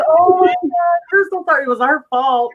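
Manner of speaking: high, gushing mock-anguished apology; apologetic parent. Parents to their crying five-year-old, apologetic.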